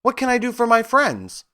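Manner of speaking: high, squeaky mouse queen; a tiny queen of the mice, squeaking politely; squeaky little voice. A narrator's reading. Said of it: "who asked in a squeaky little voice".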